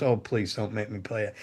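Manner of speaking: mock hushed plea to the guitar tech; quiet pleading. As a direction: plead under your breath, retelling a friend. Bassist begging again.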